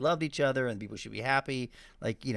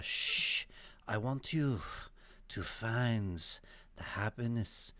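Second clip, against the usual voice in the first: put-on soft, hushed Indian guru accent, lightly done; a slow, hushed hint of Osho's Indian accent; 5 dB quieter, much slower than usual. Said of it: "say it in Osho's accent".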